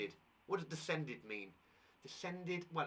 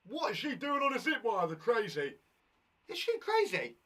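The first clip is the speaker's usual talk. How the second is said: mock amazement; 5 semitones higher, 7 dB louder than usual. Teacher mock-amazed at zip wire.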